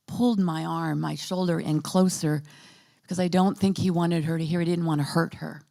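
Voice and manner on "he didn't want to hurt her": ordinary talk, nothing marked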